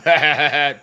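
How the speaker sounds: loud high taunting laugh; mocking troll laugh. Streamer voices the trolling friend's taunting laugh, loud and high. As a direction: cackle like the smug troll.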